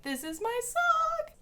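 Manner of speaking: very high, slow and dreamy. Retold or imitated. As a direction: sigh it like a smitten teen. Thirteen-year-old self, moved.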